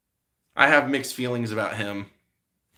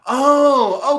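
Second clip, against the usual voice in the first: surprised oh; 12 semitones higher, 9 dB louder than usual. Real, not acted. Spots surprising chat comment.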